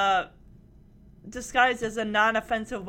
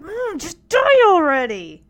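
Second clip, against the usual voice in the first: exasperated; 5 semitones higher, 8 dB louder than usual. Retold or imitated. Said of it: "Ramona's like".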